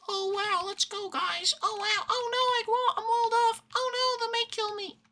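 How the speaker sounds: very high, dopey hyped gamer voice; cheer, then panic like a clueless newbie. Pretend. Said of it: "I don't mean skating into the back lines".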